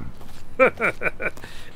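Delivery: a short, breathy laugh at the joke; chuckle, tickled by the remark; amused laugh. Acted. Holmes laughing at the officer's catchphrase joke, amused breathy laugh.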